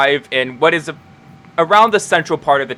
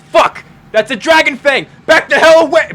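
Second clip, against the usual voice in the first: much higher, loud panicked yell; alarmed shout; 14 semitones higher, 9 dB louder than usual. Acted. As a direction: scream a warning as she backs away. Thana backs off in alarm.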